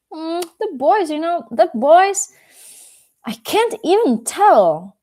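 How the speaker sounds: high, drawn-out, overwhelmed gushing; slow, loud, high-pitched wonder. Acted. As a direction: swoon over them, too amazed to describe. Dog gushes about boys in story.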